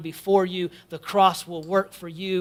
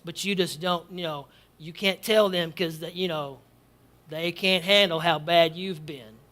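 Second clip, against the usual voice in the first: low, soft coaxing devil voice, lightly done; devil's whisper; 5 semitones lower, 6 dB quieter than usual. Retold or imitated. Preacher voicing the devil to the congregation, soft coaxing tone. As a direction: murmur like a tempter. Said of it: "The devil, though, will tell us".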